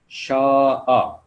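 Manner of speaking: شاء stretched very slowly, long aa then a separate final a; drawn-out pronunciation. Shown as a demonstration. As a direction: draw out the long vowel in شاء. Teacher drawing out the word shown. (شاء کو کھینچ کر، آہستہ ادا کریں)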